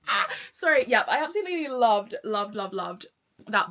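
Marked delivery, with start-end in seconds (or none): 0.1-0.3 short fangirl yelp; abrupt squeal of delight; sudden squeal. Real, not acted. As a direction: let out a quick, giddy cry. Sudden outburst after swoony quote.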